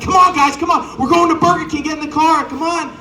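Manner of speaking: put-on rally the kids for a treat; excited mom. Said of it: "She's like".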